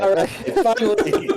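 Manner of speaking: nervous laughter. Real, not acted. Laughing relief.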